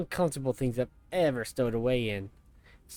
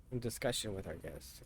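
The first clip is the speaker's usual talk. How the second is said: much softer, lower wry repeat; mock echo; 5 semitones lower, 12 dB quieter than usual. Real, not acted. Player quietly echoing the soldier's reply, wry and low. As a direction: mutter it back under your breath.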